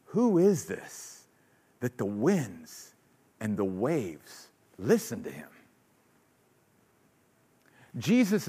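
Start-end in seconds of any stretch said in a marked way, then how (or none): 0.1-5.5 hushed, slow and amazed; awed whisper-like wonder. Acted. Disciples awed after the calm, hushed. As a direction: marvel quietly at who he is. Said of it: "the disciples look at him and go".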